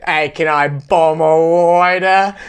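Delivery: slow, ridiculous Aussie drawl; Australian bogan parody. Retold or imitated. Podcaster quoting the ridiculous cartoon bogans, exaggerated Australian accent. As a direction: stretch it out in mock Australian. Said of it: "it's just so freaking ridiculous".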